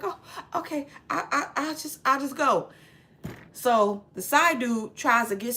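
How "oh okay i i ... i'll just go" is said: small, higher, stammering voice; mock meek retreat. Retold wife ordered out by her husband, mock meek stammer. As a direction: imitate someone timidly excusing herself.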